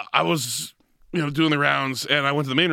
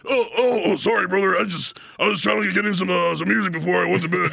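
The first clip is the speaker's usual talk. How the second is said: startled wrestler voice; 6 semitones higher than usual. Retold or imitated. Voices surprised Roddy Piper.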